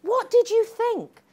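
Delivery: high, loud indignation; heated. Retold or imitated. Speaker still heated about the organiser, loud high indignation. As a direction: keep the fire up.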